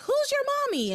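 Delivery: high-pitched imitation of yelling; mock yelling. Woman chasing the baby around town, mock yelling. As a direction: mimic a frantic shout, pitch jumping way up. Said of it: "she just keeps yelling at the".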